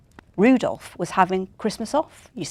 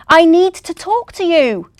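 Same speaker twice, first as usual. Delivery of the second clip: higher, louder call for attention; 9 semitones higher, 9 dB louder than usual. Acted. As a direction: call after the elf. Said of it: "the elf ignored his shout".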